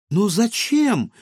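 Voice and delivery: high, slow, puzzled question; a girl honestly baffled; surprised. Acted. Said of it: "удивилась Илона".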